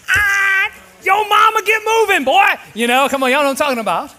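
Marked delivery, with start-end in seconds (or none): 0.1-2.5 loud, high mock yell at a slow driver; yo mama horn. Shown as a demonstration. Pastor imitating an angry driver's yo mama horn, shouting. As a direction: bellow it like a leaning-on-the-horn driver. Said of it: "there's a yo mama horn".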